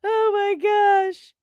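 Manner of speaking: high, slow disbelieving oh my gosh. Real, not acted. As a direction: stretch it out in amused disbelief. Reacting to chat.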